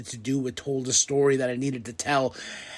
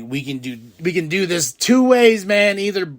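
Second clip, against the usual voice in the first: excited; 5 semitones higher, 5 dB louder than usual. Retold or imitated. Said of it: "I was like".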